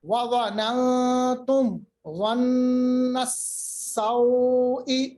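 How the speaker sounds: وَظَنَنْتُمْ ظَنَّ السَّوْءِ slowly chanted with long held sounds; tajweed demonstration. Shown as a demonstration. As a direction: close the mim of وَظَنَنْتُمْ, straight into ظَنَّ. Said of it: "میم کی آواز کو دیکھے کیسے ہم نے پڑھا". (تجوید سے کھینچ کر تلاوت)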